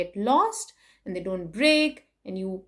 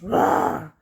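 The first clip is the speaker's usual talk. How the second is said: mock dragon roar, louder and lower; 4 semitones lower, 5 dB louder than usual. Reading to kids, a playful dragon roar. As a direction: growl it like a friendly storybook dragon.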